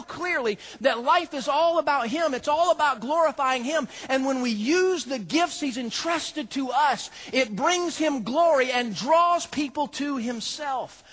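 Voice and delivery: fired-up pulpit preaching; full-force preaching. Acted. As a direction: preach it out to the crowd.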